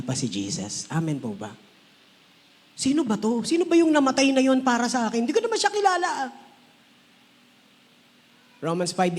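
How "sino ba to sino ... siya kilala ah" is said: higher, louder searching question; puzzled seeker. Retold or imitated. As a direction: voice someone wondering who died for them. (nagtatakang tanong ng naghahanap)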